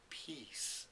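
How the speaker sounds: softly spoken, held back; quiet emotional. Retold or imitated. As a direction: say it softly, moved. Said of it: "quietly into the microphone".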